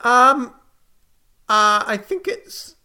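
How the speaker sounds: high-pitched, slow hesitant host; Apollo, unsure. Acted. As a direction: grope for a forgotten name in a high voice. Apollo gropes for a name.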